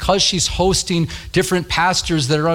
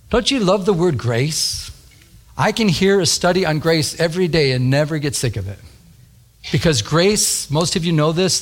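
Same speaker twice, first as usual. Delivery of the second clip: ordinary delivery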